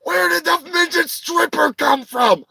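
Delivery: high, shouted disbelief; hammed-up shock at a twist in the soap; mind-blown viewer. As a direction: yell in amazed disbelief. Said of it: "you're all like".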